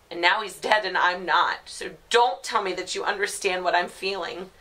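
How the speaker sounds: grief building into loud, high-pitched fury; anguished angry outburst. Acted. Grieving mother telling her family to leave her alone, furious. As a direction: start firm, rise to a raw outburst.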